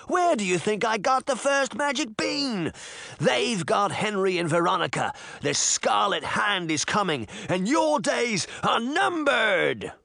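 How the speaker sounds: high, frenzied threatening rant. A narrator's reading. Jack threatening them after almost insane laughter, ranting. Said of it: "he ranted".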